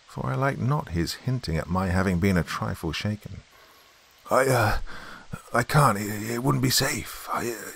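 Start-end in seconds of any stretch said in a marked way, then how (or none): 4.3-7.7 halting, higher voice breaking off; hesitant unseen stranger, trailing away; faltering stranger. A narrator's reading. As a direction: stammer uneasily, then leave it unfinished. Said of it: "The voice broke off".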